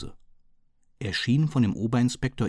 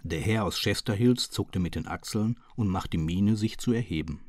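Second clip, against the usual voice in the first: plain reading voice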